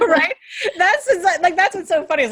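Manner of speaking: talking through laughter; laughing out the words; amused, laughing while speaking. Real, not acted. Laughing at the story.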